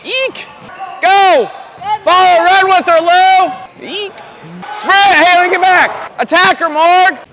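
loud, high coaching yells across the court; sideline shouting. Real, not acted. Coach yelling instructions to players on the court, loud. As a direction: call the plays out over the crowd.

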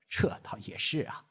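lightly voiced old man, pleased; kindly grandfather. A narrator's reading. Grandfather agreeing about future grandchildren, kindly. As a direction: concede it with a kindly ease. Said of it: "绽放了慈祥的笑容".

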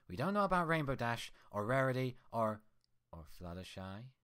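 saccharine mocking. The reflection mocking Twilight, sickly sweet. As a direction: taunt with fake sweetness. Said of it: "mocking her with a saccharine sweetness".

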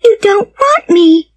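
put-on very high, upset little girl; distraught child's cry. A narrator's reading. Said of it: "cried the child suddenly".

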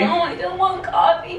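high, teary mock whine; refusing coffee in the fake breakdown, whiny; fake crying. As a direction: whine it through fake sobs. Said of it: "then I'll start the panic attack".

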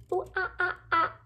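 high monkey "oh ah ah" calls; monkey noises. Acted. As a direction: chatter like a monkey. Monkey noises before reveal.

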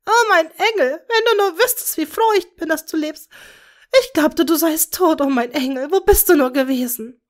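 high, loud, tearful outpouring; weeping with joy. A narrator's reading. Bellatrix holding her found daughter, weeping with joy. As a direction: sob out relief and love. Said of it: "Als Bellatrix anfing zu weinen".

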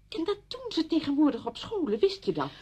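high, loud, eager suggestion; insistent, pitched right up; urgent persuasion. Acted. Friend urgently suggests reasons to live.

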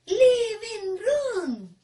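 felices, voz alta y aguda, sílabas alargadas; feliz. Shown as a demonstration. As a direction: dilo feliz, fuerte y estirado. (feliz, alegre y fuerte)

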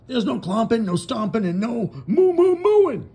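Farmer Brown mocking moos. Farmer Brown lists banned cow noises, mock mooing. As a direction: ham up the forbidden noises. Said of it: "said Farmer Brown".

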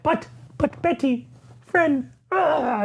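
high, slow, whimpering Hulk voice; a wounded brute pleading in a high whine; pitiful Hulk. Retold or imitated. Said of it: "he's like".

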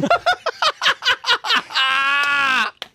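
high shrieking laugh. Real, not acted. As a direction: burst out howling with laughter. Laughs after the punchline.

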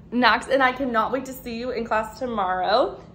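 ordinary delivery

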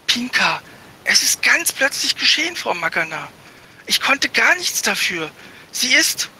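put-on a boy scrambling to justify himself; flustered young boy. Barley's flustered boyish voice. (ein verlegener Junge, hoch und flehend)

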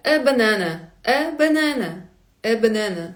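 "е банана" as eh buh-NEH-nuh; mouth not wide open. Shown as a demonstration. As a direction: keep the vowels of "е банана" half closed. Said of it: "не відкривати сильно ротом".

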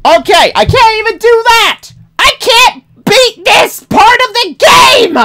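high crying frustrated shout; raging gamer. Real, not acted. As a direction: wail in real frustration.

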